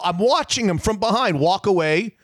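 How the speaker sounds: ordinary talk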